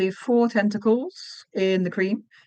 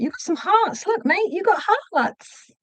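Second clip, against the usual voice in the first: cooing to the toy; 11 semitones higher than usual. Acted.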